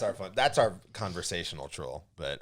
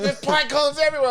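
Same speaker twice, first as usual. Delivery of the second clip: high, loud mock protest; retold shrieked complaint; 7 dB louder than usual. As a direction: imitate her yelling in dismay. Retold complaint, thrown onto the bed.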